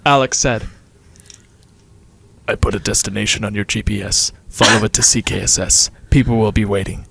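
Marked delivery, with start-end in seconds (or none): 2.5-7.0 low-pitched, ominous caller; mysterious caller voice. A narrator's reading. The caller sends the group to the school, low menacing voice. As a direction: drop low and give orders as the unknown voice. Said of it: "The voice hung up".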